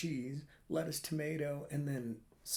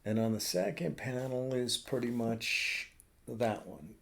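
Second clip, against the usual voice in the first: ordinary talk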